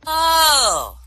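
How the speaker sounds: put-on loud, high drunken "oh". Drunk reacting to his prize, loud high drunk voice. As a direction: let a drunk's exclamation swoop down from high. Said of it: "says the drunk".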